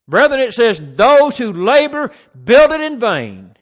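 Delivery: loud, slow pulpit preaching; preaching at full force. Acted. Preacher driving the psalm home to the brethren, loud and slow. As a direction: thunder the verse out to the church.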